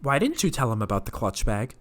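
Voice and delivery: imitating the manager's tone and cadence; mimicked boss questioning "clutch bag" line; exact manager tone. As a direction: copy her scolding cadence word for word. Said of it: "in that exact tone and cadence".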